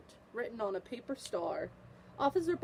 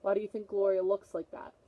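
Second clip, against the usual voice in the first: usual speaking voice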